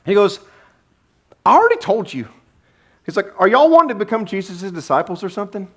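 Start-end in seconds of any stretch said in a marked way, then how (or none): 1.4-2.3 high-pitched exasperated comeback; mock exasperation; sarcastic retort. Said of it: "this is just pure sarcasm here". 3.4-5.7 high, quick mock-innocent question; pitched-up sarcastic jab. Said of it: "this is just pure sarcasm here".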